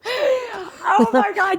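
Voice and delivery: drawn out through laughter; laughing. Real, not acted. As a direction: laugh the words out slowly, high. Laughing fit.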